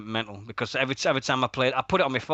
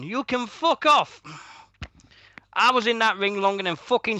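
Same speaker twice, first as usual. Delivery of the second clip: defiant; 7 semitones higher than usual. Real, not acted. Defiant boast at rival.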